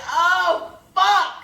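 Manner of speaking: loud, high cry of dismay; startled curse, played for laughs; retold alarmed outburst. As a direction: re-enact the moment of slipping. Acts out slipping on the steps.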